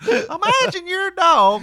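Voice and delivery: high, slow, drawn-out comic voice; Jeff Foxworthy impression. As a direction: set it up like a stand-up bit. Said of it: "that's more Jeff Foxworthy".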